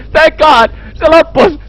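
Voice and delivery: high, shouted relief with laughter; overjoyed relief. Real, not acted. Player as the scare ends, relieved high shout.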